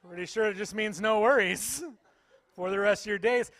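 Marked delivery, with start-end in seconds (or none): none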